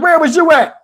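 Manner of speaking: Steve asking. Retold or imitated. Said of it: "My man Steve was like".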